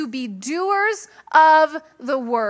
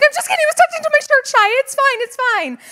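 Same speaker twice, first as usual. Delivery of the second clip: high, loud pleading reassurance; soothing son; 11 semitones higher, 5 dB louder, much faster than usual. Retold or imitated. Mother hurrying to calm her upset son about her hair.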